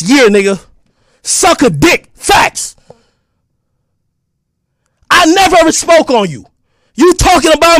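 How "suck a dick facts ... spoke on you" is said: usual speaking voice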